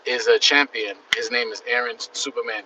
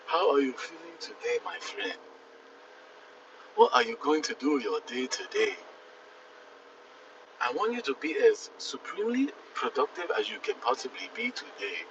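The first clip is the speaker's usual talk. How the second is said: lightly voiced African accent; 2 semitones higher, 9 dB quieter than usual. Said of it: "African accent".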